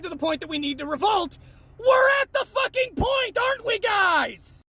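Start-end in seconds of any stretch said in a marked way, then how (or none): 1.8-4.6 high, drawn-out yell; exasperated shout. Real, not acted. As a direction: shout it as an angry appeal to the group. Exasperated call to followers.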